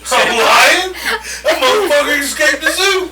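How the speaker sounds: high, loud laughing disbelief. Real, not acted. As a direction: crack up while shouting it.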